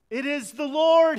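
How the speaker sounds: high, loud cry of recognition; "It is the Lord" cried out high and loud; exclaimed recognition. Retold or imitated. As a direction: call it out as if you've just recognised him. John recognising Jesus, exclaiming.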